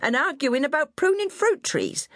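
high, bossy West Country nanny voice; scolding old countrywoman. A narrator's reading. Old nurse scolding her fallen charge, high and bossy.

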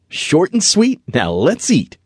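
put-on high, playful boy voice with a touch of mirth; understated storybook chortle, no real laugh; chortling. A narrator's reading. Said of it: "Christopher chortled".

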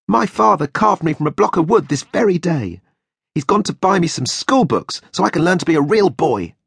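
put-on high boy voice, proud; Pinocchio. A narrator's reading. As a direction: wooden boy bragging about his father.

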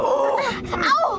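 sharp yelp of pain; pained cry. Acted. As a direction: cry out, hurt. Kicked by a horse.

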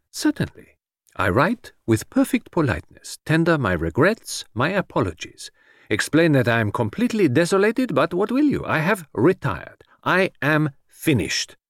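high foreign detective, slowing to solemn finality; Poirot, grandly final. Acted. Detective dictates his apologies and retirement, grandly final. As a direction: announce retirement with theatrical regret.

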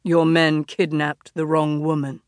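low, dark wolf voice; deep and smoky. Acted. The wolf tells the Dionysus, deep and smoky. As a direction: drop it deep and let it smoke. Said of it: "His voice was deep and smoky".

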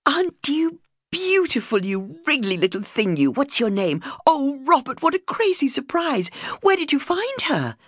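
high, gushing coo to a puppy; wife gushing over a new puppy, high and excited; cooing and thrilled. Retold or imitated. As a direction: melt over the puppy, then bubble at him. Cooing over puppy, then asking husband.

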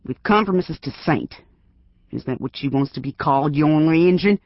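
put-on gruff old self. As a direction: threaten through the door, building to a yell. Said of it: "sounding more like his old self".

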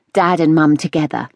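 ordinary delivery, nothing marked

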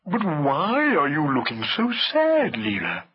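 slow, ponderous elephant voice; drawn-out, doleful talking elephant; concerned elephant. A narrator's reading. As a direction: ask gently, stretching the words. Hamlet asks Leela.

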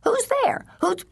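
very high-pitched squeak; squeaky, high demand, cut off; Miss Betty Ramsey. A narrator's reading. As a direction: squeak it down the phone. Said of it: "Miss Betty's voice squeaked".

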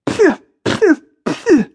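high, loud spitting puffs; spluttered phew. A narrator's reading. Maya choking on a first drag, spluttering. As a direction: splutter out short bursts between coughs. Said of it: "gets enough breath for a moment to say".

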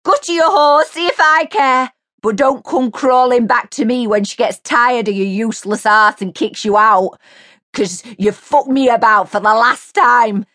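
high, loud furious shriek. A narrator's reading. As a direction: scream the break-up at him. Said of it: "Mary shrieked at his back".

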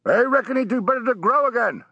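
ship's sailor. Acted. Sailor giving his view on a passenger's shaved whiskers, confidingly.

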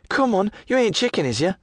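put-on high, needling young woman voice; teasing lass.

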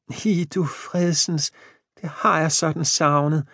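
she gets her lost corset back; a high, happy old woman's gasp; delighted old wife. A narrator's reading. Jane delighted.

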